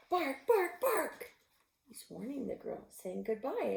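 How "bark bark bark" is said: high loud barking like a dog. Acted. The girl's dog warning her, loud high barking. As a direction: bark it out like an excited dog. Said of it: "The dog".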